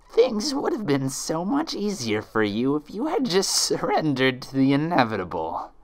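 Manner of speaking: high mocking sneer; sneering monster. Monster mocking Twilight, cruel sneer. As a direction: taunt her cruelly.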